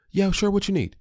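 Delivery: dad answering his son straight away, high and cheerful; ready dad. Retold or imitated. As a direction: answer the kid right away, bright and willing. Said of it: "I've made that a part of".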